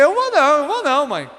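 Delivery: high-pitched scared refusal; frightened child. Retold or imitated. Son afraid of his angry mother, high scared refusal. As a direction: plead your way out of going to mum. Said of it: "Eu já ficava com medo".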